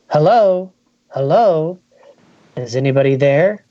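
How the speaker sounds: ordinary delivery, nothing marked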